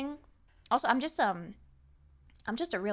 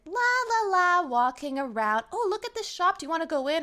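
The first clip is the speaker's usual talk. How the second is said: dawdling friend; 8 semitones higher, 7 dB louder than usual. Retold or imitated. Mimicking a dawdling companion.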